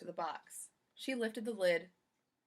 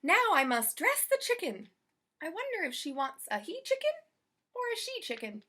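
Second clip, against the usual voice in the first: a higher, lightly voiced eager housekeeper; Amelia Bedelia voice; 9 semitones higher, 5 dB louder than usual. Amelia Bedelia wondering aloud about dressing the chicken, lightly voiced higher. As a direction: sound puzzled but keen about the chicken.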